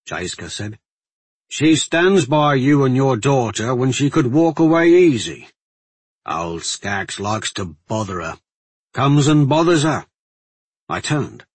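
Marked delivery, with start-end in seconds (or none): 1.5-10.1 loud, emphatic guardsman's voice; plain-spoken guardsman, scolding. Acted. Guardsman defending a woman to his friend, scolding. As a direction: press the point as a blunt guardsman.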